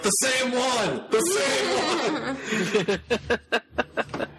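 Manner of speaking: high, slow, laughing punchline; emphatic. Retold or imitated. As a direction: stretch the punchline out gleefully. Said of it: "he's like".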